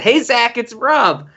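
high catchphrase greeting; Ernest. Acted. Co-host greeting his podcast partner, mimicking the character's high call. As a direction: pitch it up like a goofy show catchphrase. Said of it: "That's what I was going for".

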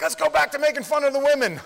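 high, loud jokey heckler shout; mock heckler. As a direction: shout it like a rowdy guy in the crowd. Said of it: "Dude's like".

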